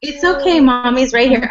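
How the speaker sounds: high, gentle soothing voice. Real, not acted. Mother calming fussing toddler.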